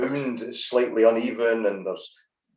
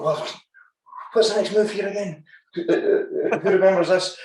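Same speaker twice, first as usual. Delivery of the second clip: higher-pitched mock asking around; imitating puzzled students; 9 semitones higher than usual. Students helping each other in class, imitated in a higher voice.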